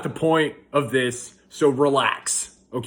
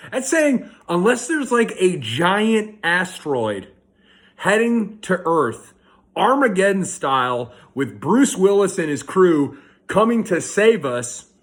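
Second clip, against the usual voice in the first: higher, hammed-up disaster scenario; mock exaggeration; 4 semitones higher, 3 dB louder than usual. Dad mocking "not the end of the world", exaggerated. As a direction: build an absurd doomsday case, emphatically.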